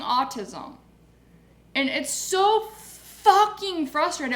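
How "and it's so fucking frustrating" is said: slow, loud, higher outburst; frustrated anger. Real, not acted. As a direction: drag out the frustration, near breaking. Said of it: "I'm just angry".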